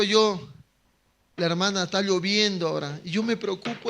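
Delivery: ordinary talk, nothing marked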